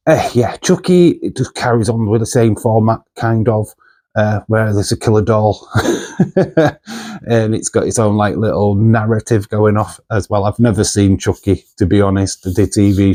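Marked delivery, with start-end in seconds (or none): 5.7-7.2 a bright burst of real laughter; short laugh. Real, not acted. Laughs at killer doll premise.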